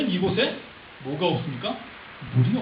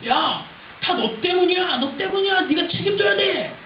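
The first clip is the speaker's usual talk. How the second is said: high-pitched accusing yell; blaming outburst; 9 semitones higher, 4 dB louder than usual. Acted. People turn on Moses, blaming him in a high-pitched outburst. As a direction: throw the blame at him, voice way up. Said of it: "백성들은 모세를 향해서".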